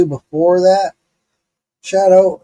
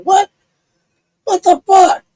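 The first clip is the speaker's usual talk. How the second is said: high, louder outburst; shocked disbelief; 14 semitones higher, 7 dB louder than usual. Retold or imitated. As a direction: blurt it out in comic disbelief. Retold shock at paramedic's answer.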